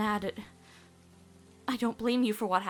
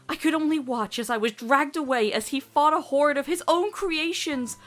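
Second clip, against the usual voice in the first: building from firm to a loud anguished cry; grieving daughter shouting her loss; rising grief; 2 semitones higher, 10 dB louder than usual. Acted. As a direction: push harder till My dad breaks out. Amity recounts her dad's sacrifice.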